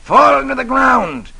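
high, slow booming villain command. Acted. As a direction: bark the order like a tyrant.

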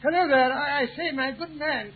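loud call to a distant man. Acted. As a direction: hail him from afar. Calling out to the footman.

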